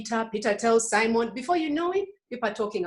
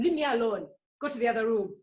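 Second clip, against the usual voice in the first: normal talking voice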